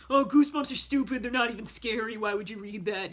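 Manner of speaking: mock scornful schoolkid attitude; imitating unimpressed classmates; dismissive kids. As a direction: put on a kid's disdain. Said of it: "that was our attitude".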